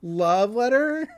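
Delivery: drawn-out, questioning disbelief; confused. Retold or imitated. Club members hearing of a love letter, slowly puzzled. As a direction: act out slow bafflement. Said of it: "like, confused".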